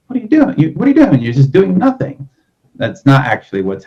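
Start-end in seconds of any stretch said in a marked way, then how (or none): 0.1-2.3 incredulous skeptic. Retold or imitated. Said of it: "it's like".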